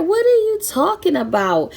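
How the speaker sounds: high, disbelieving what are you talking about; baffled. Retold or imitated. Retelling her reply to siblings, high and disbelieving. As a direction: sound floored by the question. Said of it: "I'm like".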